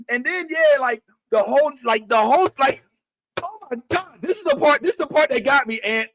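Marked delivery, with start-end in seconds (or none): none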